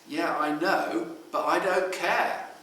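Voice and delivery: put-on loud, high, dismissive teen; a teenager insisting loudly she doesn't care; defiant. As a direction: toss it off loudly, refusing to care. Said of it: "defiant teenager quoted".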